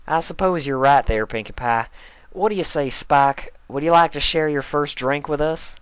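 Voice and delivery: lightly voiced country pony; Applejack voice. A narrator's reading.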